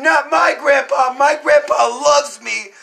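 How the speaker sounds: high, loud, drawn-out protest; imitated crying kid. Storyteller as his crying younger self defending grandpa, loud wail. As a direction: wail it like a scared child, hammed up. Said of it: "and I was crying".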